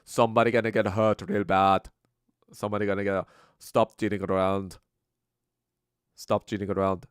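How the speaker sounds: put-on loud Indian accent routine; stern warning in a put-on Indian accent; Russell Peters. Doing a comedian's bit, threatening in an Indian accent. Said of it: "Russell Peters thing".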